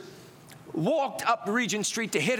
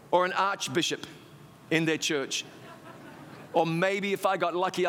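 ordinary delivery

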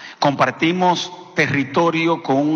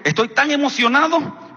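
excited; 8 semitones higher than usual. Retold or imitated. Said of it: "estaba tan emocionado".

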